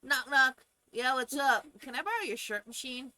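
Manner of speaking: high, loud knock-knock bit; playful neighbor voice. Pretend. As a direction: play the cheeky neighbor at the door. Acts out asking a neighbor.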